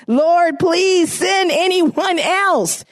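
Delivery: drawn-out pleading plea; pleading shout. Acted. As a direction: wail it out as a desperate Moses. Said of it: "But Moses pleaded".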